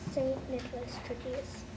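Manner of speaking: usual speaking voice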